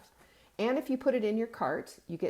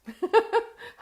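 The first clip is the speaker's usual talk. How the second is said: high burst of laughter; 9 dB louder than usual. Real, not acted. Exasperated laugh about labels.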